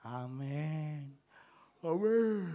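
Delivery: amenes muy bajitos y alargados; amén apagado. Acted. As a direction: responde sin fuerza, casi para dentro. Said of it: "me está diciendo". (amén débil, en voz muy baja)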